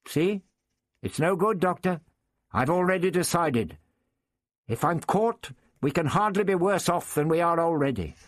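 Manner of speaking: put-on a young girl standing firm. Young girl pleads with the doctor.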